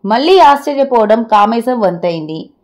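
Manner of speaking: plain reading voice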